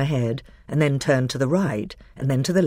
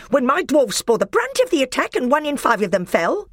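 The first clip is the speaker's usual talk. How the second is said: high loud fast retort; furious higher dwarf; angry dwarf; 13 semitones higher, 6 dB louder than usual. A narrator's reading. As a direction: snap back in fury. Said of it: "came the angry voice".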